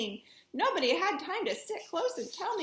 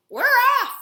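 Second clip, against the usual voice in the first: group shout; 9 semitones higher, 13 dB louder than usual. Acted. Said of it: "we all shouted".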